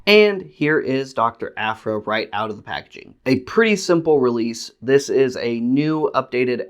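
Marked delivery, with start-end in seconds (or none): none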